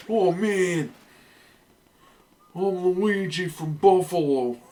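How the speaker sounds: put-on high, goofy Buffalo accent as Luigi. Player joking as Luigi from Buffalo, in a goofy Buffalo accent. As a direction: play Luigi as a dopey Buffalo local. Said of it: "in a stupid Buffalo accent".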